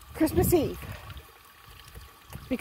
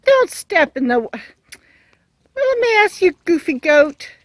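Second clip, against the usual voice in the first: louder call, jumping high on little miss; calling out warning; 5 semitones higher, 8 dB louder than usual. Real, not acted. Owner warning the goat off the water, loud call. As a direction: call out to stop the goat stepping in.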